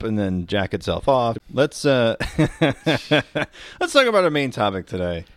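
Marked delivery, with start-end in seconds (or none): none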